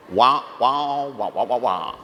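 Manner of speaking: muted trombone wah-wah drone; droning wah-wah like a muffled horn; Charlie Brown's teacher. Retold or imitated. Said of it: "you start hearing Charlie Brown's teacher".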